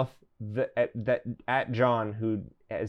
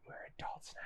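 hushed whisper, all breath; 25 dB quieter, much faster than usual. Acted. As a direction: whisper it close. A whispered line for a character.

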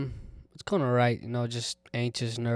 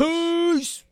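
squeaky high voice; 8 dB louder than usual. Acted.

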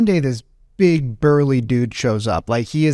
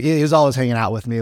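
usual speaking voice

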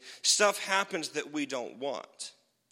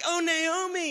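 mock pity from the women of the town; pitying townswomen; 5 dB louder than usual. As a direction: coo it like gossiping neighbours. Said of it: "the women of the town like".

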